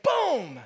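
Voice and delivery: imitated crash of the van on the curb; loud boom. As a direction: slam the word out like the impact. Retold crash against the curb.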